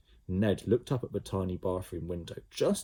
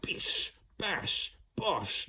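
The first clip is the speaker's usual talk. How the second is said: kicking sound effect; 11 semitones higher, 3 dB quieter, much slower than usual. A narrator's reading. Sound of boots kicking door.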